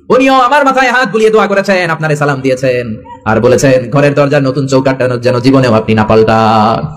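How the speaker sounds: ordinary talk, nothing marked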